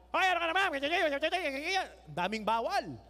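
loud, high scolding mother; imitated nagging mom. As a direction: nag like a mom on the radio.

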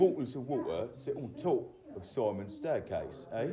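put-on British accent. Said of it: "I love your British accent".

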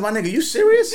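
loud, high, fed-up challenge; disbelieving, heated shout; incredulous outburst. Retold or imitated. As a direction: call out his nonsense, raising the voice. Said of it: "I went off".

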